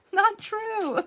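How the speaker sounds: very high, loud squealed denial; squeaky, indignant retort; mock protest. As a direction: protest in playful outrage. Her acted protest, laughing.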